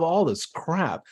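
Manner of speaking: ordinary delivery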